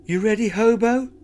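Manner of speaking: put-on eager girl voice. Lucy asks eagerly.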